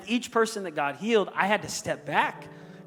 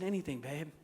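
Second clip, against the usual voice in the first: 1 hushed tender reply; 3 semitones lower, 11 dB quieter than usual. Retold or imitated. Husband answers dying wife tenderly.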